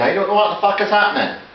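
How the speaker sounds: ordinary talk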